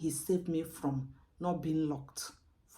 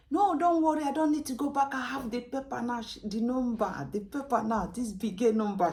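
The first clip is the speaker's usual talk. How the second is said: high, loud excited announcement; retelling her own eager reply, much higher and louder; triumphant; 8 semitones higher, 8 dB louder than usual. Retold or imitated. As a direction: burst in, proud of the new paper. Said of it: "I say".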